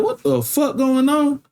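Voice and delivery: high, slow, stunned disbelief; shocked. Retold or imitated. Man surrounded by police asks what's happening, high and stunned. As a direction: sound floored by all the cops. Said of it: "I'm like".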